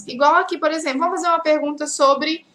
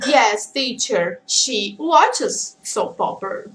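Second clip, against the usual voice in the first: slow model answer; 5 semitones lower, much slower than usual. Shown as a demonstration. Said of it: "você pode responder".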